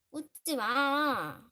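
loud, long drawn-out complaint; annoyed call-out. Real, not acted. Streamer telling someone not to laugh, loud and annoyed. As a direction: stretch it out loudly in protest.

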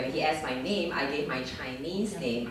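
ordinary talk

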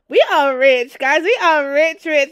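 thrilled shout, nearly squealing; excited shouting. Real, not acted. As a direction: cheer at the sudden fortune. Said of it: "I'm so excited".

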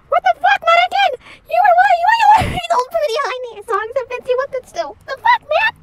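high, loud outraged yell; shocked shout. Acted. Friend walking in, shouting in shock. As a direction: blurt it out in shock.

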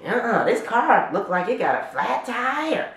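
a hint of insistent mom; mom's voice. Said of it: "And my mom".

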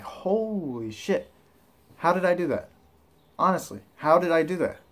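amazed disbelief. Retold or imitated. Amazed quote of own reaction.

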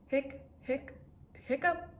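hiccups. Acted. Clifford hiccups at breakfast.

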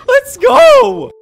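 high laugh into a loud shout; laugh, then shout in triumph; gleeful cheer. Real, not acted. Gleeful about taking all cars.

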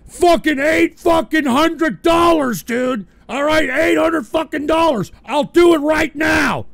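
loud, high, slow imitated offer; mock insistent yell. Streamer imitating Yak Ass shouting his bid. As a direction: bark the offer like the pushy donor. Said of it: "You know what I'm gonna do?".

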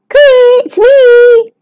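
high, loud sing-out greeting; calling out. Acted. Stanley calls to his friends, high cheery call. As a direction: call across the water, drawn high. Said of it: "called Stanley to Percy Turtle and Puffer Fish".

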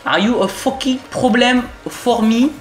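slow, high, put-on English taunt; hammed-up confrontational English; mock-aggressive challenge. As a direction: drag out a pretend threat, voice pushed up.

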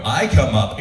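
usual speaking voice

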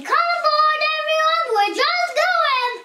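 high hooting announcement; high-pitched boarding call; hooting siren. A narrator's reading. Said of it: "Its siren was hooting".

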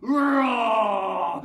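loud, high, full roar; shouted playful lion roar; big lion roar. Shown as a demonstration. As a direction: roar to scare the kids. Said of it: "scared me with that roaring".

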